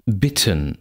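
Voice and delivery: "bitten" with a short open i; short lax vowel. Shown as a demonstration. Lecturer reads minimal pairs, short lax vowel.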